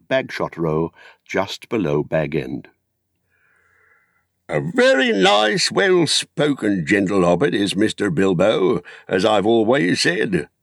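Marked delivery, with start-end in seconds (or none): 4.5-10.5 put-on old Gaffer. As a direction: hold forth like a proud old gardener at the inn. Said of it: "the Gaffer declared".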